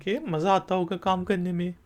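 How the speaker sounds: normal talking voice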